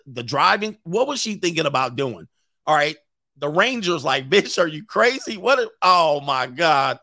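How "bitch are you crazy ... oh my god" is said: mock incredulity. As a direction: imitate a ranger aghast at her. Acts out the alarmed ranger.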